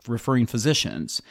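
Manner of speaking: ordinary talk, nothing marked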